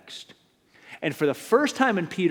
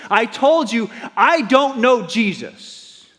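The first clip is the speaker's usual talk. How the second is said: higher, louder, slow outburst; furious shout; 11 semitones higher, 11 dB louder, slower than usual. Acted. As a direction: Peter losing it. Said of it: "Peter loses it and says".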